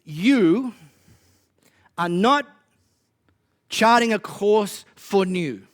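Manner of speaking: building sermon delivery, forceful; preaching. Real, not acted. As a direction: preach it with rising conviction.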